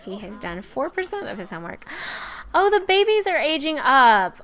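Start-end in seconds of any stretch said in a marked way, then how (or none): 1.9-2.5 sharp intake of breath in surprise; quick shocked inhale; surprised gasp. Real, not acted. Surprised by babies aging up. 2.5-4.4 high, loud burst of surprise; loud, high-pitched surprise; startled exclamation. Real, not acted. Surprised by babies aging up.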